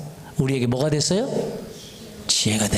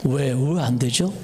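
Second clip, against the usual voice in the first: ordinary talk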